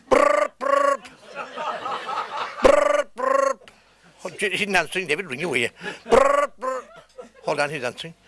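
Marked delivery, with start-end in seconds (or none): 0.1-3.6 high trilled brrr like a phone ringing; phone ring imitation. 6.1-6.8 high trilled brrr like a phone ringing; phone ring imitation.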